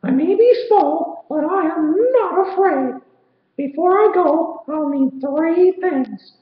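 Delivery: a tiny hero declaring he's had enough; squeaky cartoon mouse full of resolve; Mickey's voice. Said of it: "said Mickey".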